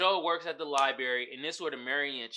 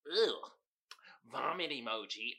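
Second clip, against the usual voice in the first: disgusted mock quote; 5 semitones lower, 5 dB quieter than usual. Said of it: "One time she said".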